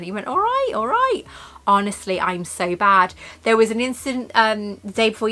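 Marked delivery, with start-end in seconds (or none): 0.3-1.2 high mock-grudging giving in; imitating a fed-up husband. Mimicking Adam giving in, high and grudging.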